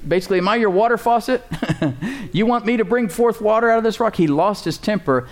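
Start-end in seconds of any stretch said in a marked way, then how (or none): 0.1-4.2 higher, exasperated shout at the crowd; angry yell. Retold or imitated. Moses yelling at the people of Israel in anger. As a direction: vent like a man losing his temper. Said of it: "he yelled at the people".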